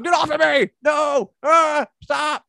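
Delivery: high loud panicked yells; struggling shouts. Retold or imitated. Braun shouts as druids drag him away, high and loud.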